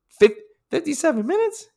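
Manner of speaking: high incredulous squeal of disbelief; can't believe his own time; amazed. Real, not acted. Vlogger amazed at his swim split, squealing high.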